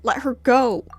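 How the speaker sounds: loud firm order; commanding shout. Acted. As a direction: bark it as a command.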